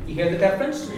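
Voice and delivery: ordinary delivery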